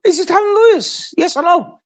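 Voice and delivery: high-pitched, exasperated questioning; insistent demand. Real, not acted. Pressing a rival on whether she is lying, insistent. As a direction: push the repeated question up high, demanding an answer. Repeats unanswered question in a quarrel.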